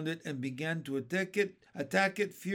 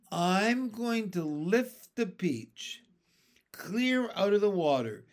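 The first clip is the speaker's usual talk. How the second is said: firm announcement; 5 semitones higher, 3 dB louder than usual. A narrator's reading. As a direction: lay out the plan with conviction. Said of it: "James announced firmly".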